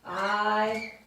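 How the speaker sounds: stretched "I", long pause, then "love you"; slow dictation. Shown as a demonstration. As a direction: say it in pieces while writing it large. Let's do a nice big one.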